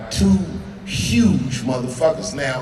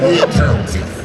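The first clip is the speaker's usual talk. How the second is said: taunt; 6 dB louder than usual. Retold or imitated. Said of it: "but I said".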